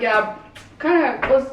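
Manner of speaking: too-cool attitude. Retold or imitated. Old self brushing off a friend's affection, aloof. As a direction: brush off a friend who missed you. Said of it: "it was that kind of attitude".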